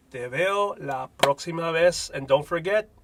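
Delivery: ordinary talk, nothing marked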